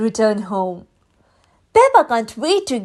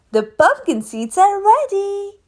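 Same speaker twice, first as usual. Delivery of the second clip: calling out; 7 semitones higher than usual. A narrator's reading. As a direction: call the kids to the treat. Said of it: "Daddy Pig calls".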